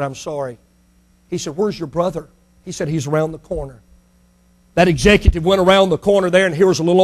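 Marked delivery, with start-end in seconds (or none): 0.0-3.8 soft, low, humbled; hushed apology. Acted. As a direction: say it softly, ashamed. Humbled executive, hushed exchange.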